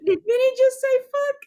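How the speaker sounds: high-pitched, amused disbelief; mock shock. Group reacts to Minnie cursing, high shocked squeal. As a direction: squeak it out, scandalized.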